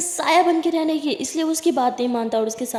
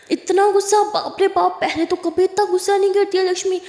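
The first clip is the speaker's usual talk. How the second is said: shocked disbelief; 8 semitones higher, 4 dB louder than usual. A narrator's reading. Lily shocked at Lakshmi's anger.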